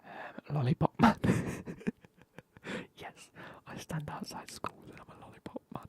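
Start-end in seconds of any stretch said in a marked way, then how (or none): 0.6-1.2 sudden outburst. Real, not acted. Blurting a job guess aloud.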